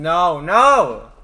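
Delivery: loud, shouted "no, no"; loud protest. Real, not acted. Protesting a cringe joke.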